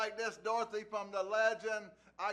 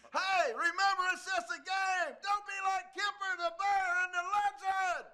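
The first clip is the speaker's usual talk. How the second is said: higher, louder show-closing yell; loud sign-off shout; 9 semitones higher, 6 dB louder than usual. Acted. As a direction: boom out the sign-off to the listeners.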